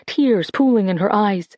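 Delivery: usual speaking voice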